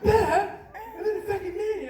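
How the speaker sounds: high-pitched mock scolding; high, indignant mimicry of a wife; retold exasperated wife. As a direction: put on a high, fed-up yelp. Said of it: "yelled at her. And she was like".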